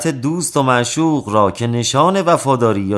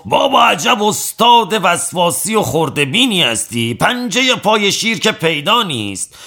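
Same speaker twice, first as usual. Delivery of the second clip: exasperated shout; 9 semitones higher than usual. A narrator's reading. Strongman yelling at the fussy tattooist, exasperated shout. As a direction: throw up your hands and yell at him.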